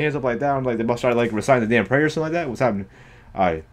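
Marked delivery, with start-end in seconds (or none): none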